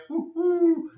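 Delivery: high, airy two-note hoot; owl hoot. Retold or imitated. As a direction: imitate an owl at night. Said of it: "We have owls at night".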